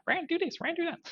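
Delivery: high, squeaky inner voice. Retold or imitated. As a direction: pitch it way up like a nagging thought. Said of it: "internal Rand going".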